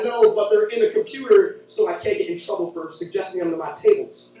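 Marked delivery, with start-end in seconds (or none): none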